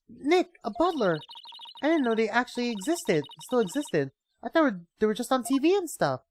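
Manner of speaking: put-on high, amazed young woman; excited girl voice. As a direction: gasp in delight. Maya amazed.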